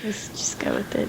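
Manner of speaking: soft unvoiced whisper. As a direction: breathe it out quietly. Echoes quote in whisper.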